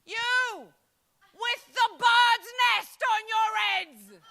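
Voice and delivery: loud, high, slow laughing yell; shouting back. Retold or imitated. Woman shouting back at the builders, loud and laughing. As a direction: fire it back at them with a laugh.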